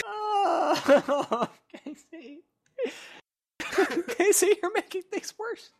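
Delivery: high, breathless giggling through the words; can barely get it out for laughing; real laughter bubbling over every word; laughing helplessly. Real, not acted. Laughing at friend's teasing.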